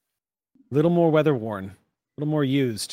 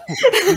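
high laugh; 8 dB louder than usual. Real, not acted. Group laughing at clip joke.

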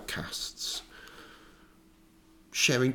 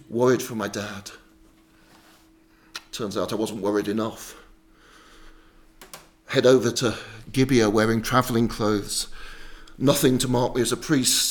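plain reading voice

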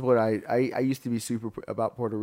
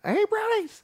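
squeaky little creature voice; 4 dB louder than usual. Retold or imitated. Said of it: "The little guys are called".

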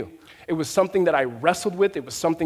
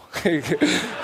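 real laugh. Real, not acted. Laughs after an awkward joke.

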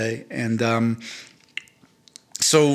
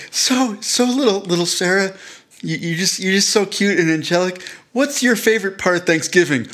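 man doing a woman's voice; 7 semitones higher than usual. Pretend. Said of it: "[name]'s little guy".

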